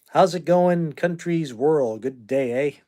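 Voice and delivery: a hint of Canadian accent, slowed down. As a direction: greet the chat in a hammed-up Canadian accent. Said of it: "I sound like a Canadian".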